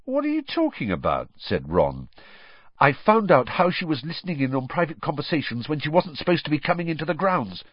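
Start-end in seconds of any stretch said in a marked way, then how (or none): 0.0-1.3 high, puzzled question; mildly incredulous query; baffled question. A narrator's reading. Ron puzzled, questioning Hermione. 2.8-7.6 fast, high, breathless tumble of words; rushed high confession. A narrator's reading. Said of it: "said Hermione in a rush".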